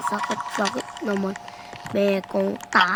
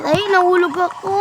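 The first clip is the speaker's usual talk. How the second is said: loud high yelp; screaming while falling; 10 semitones higher, 6 dB louder than usual. Real, not acted. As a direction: shout in shock as you fall. Falls again. (sigaw habang nahuhulog)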